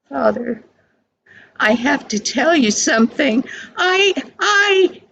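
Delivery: put-on a young boy stammering out a confession; boy's voice. A narrator's reading. Boy confessing to his father.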